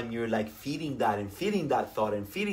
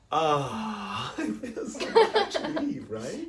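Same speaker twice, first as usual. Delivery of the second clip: ordinary talk, nothing marked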